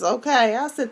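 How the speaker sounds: ordinary talk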